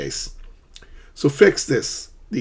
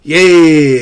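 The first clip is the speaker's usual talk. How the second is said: much louder, high cheer; excited yay; 5 semitones higher, 15 dB louder than usual. Shown as a demonstration. Teacher demoing excitement to students, loud cheering yay. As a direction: cheer like you just won.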